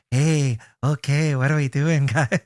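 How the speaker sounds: lightly voiced old movie creep; a hint of a sly 1940s villain; Peter Lorre impression. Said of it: "Peter Lorre".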